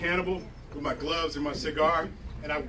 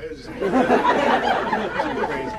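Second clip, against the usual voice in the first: laughing; 4 dB louder, much slower than usual. Real, not acted. Laughing at own bit.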